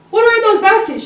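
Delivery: put-on high, loud eager child asking; curious kid question. Young kid loudly asking mom what's in the baskets.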